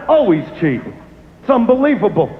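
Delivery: ordinary delivery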